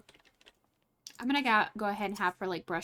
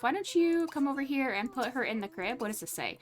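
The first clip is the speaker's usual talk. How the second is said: normal talking voice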